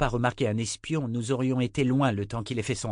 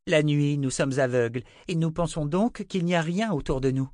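higher-pitched, insistent lecturing; earnest young believer, preaching; 7 semitones higher than usual. Acted. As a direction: explain your creed in a young man's voice. Owen expounds his creed.

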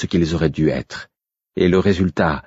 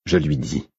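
ordinary delivery, nothing marked

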